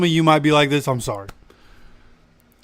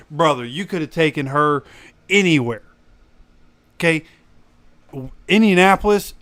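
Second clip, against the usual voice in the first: usual speaking voice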